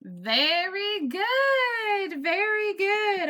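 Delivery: high, slow, stretched-out praise; excited praise. Real, not acted. Teacher praising first graders.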